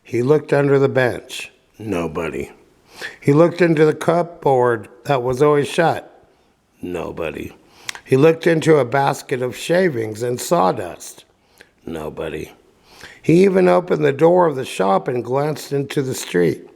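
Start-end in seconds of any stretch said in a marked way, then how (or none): 1.8-2.5 quiet. A narrator's reading. Searching the shop, repeated nobody. 6.8-7.5 dropped low and soft; hushed nobody. A narrator's reading. Narrator's hushed verdict on the empty shop, very soft. 11.8-12.5 dropped low and soft; hushed nobody. A narrator's reading. Narrator's hushed verdict on the empty shop, very soft.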